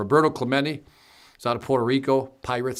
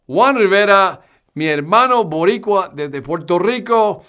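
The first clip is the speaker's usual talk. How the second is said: excited shout-out; 5 semitones higher, 6 dB louder than usual. Acted. Reading a Puerto Rican viewer's greeting.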